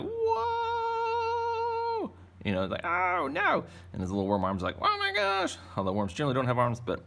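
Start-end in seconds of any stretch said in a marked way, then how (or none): 0.0-2.1 very high squeaky cartoon whoa; squeaky worm cry. Cartoon worm swimming fast. 2.8-3.6 high, loud cartoon cry; panicked little worm. 4.8-5.6 very high squeaky cartoon whoa; squeaky worm cry. Worm's little arm reacting.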